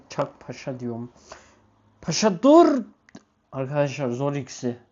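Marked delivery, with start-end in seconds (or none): 2.0-2.8 high, loud stop command; sharp command to dog. Real, not acted. Owner shouting at his dog Paşa to stop, high and loud.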